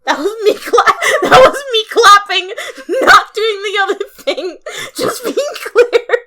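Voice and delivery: high, laughing words. Real, not acted. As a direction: laugh as you explain. Laughing at own noise.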